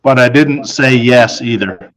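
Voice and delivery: plain reading voice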